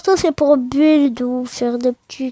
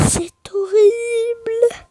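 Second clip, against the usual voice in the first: geignard, aigu et très lent; pleurnicherie exagérée; 8 semitones higher, much slower than usual. Pretend. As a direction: plainte théâtrale, voix qui pleure. (faux sanglot aigu et traînant)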